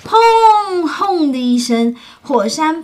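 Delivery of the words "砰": high, loud explosion sound; loud boom. Acted. Volcano erupting in the story, loud boom sound. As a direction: burst out with a big bang.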